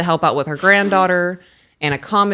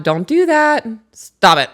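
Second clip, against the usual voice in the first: firm, louder parental command; firm telling-off; 5 semitones higher, 6 dB louder, slower than usual. Retold or imitated. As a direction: raise the voice and lay down the rule. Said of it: "told her again more firm".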